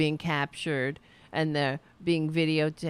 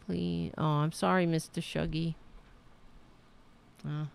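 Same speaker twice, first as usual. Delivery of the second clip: normal talking voice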